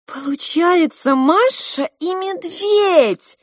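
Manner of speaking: high, loud, drawn-out exclamation; sudden realisation. A narrator's reading. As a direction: cry out as it dawns on you. Маринка внезапно догадывается. (восторженная догадка)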